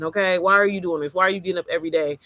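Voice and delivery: ordinary delivery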